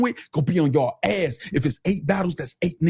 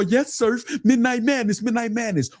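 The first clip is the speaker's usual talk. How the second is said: higher, fawning "yes, Surf"; mock fawning fan; 7 semitones higher than usual. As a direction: sneer as a groveling fan. Mocking fans begging Surf.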